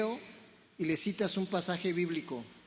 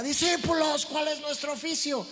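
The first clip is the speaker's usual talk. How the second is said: higher, louder call to the crowd; rallying shout; 8 semitones higher, 5 dB louder than usual. Retold or imitated. Someone calls out to the church, loud rallying shout. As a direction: shout it like a leader. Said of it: "Entonces alguien grita".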